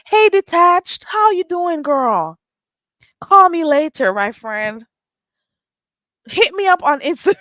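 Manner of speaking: high, bubbly put-on greeting; mock fake friendly. Pretending to be close with a chatter, mock gushing. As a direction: gush like a phony friend while fighting a laugh. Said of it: "I can't even say it with a straight face".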